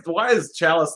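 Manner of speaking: usual speaking voice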